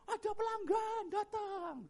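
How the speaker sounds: high, slow singsong call; wife calling out sweetly. Retold or imitated. Wife calls husband when the warung is busy, high drawn-out call. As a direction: stretch it out in a high voice.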